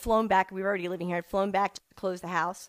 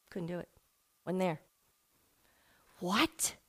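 ordinary talk

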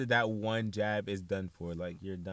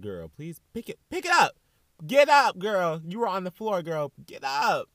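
loud, higher bark to get up off the floor; tough-love shout; 8 semitones higher, 10 dB louder than usual. Pretend. To a girl crying on the floor, a loud tough-love shout. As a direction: yell at her to snap out of it.

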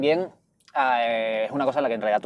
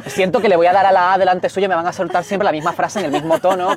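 ordinary delivery

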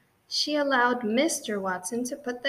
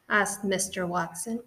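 plain reading voice

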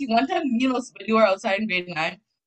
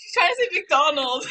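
laughing; 9 semitones higher than usual. Real, not acted. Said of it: "friends laughing at the story".

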